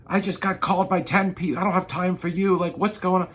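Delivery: mock irritated customer voice; harried lead. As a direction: act out a swamped, fed-up lead.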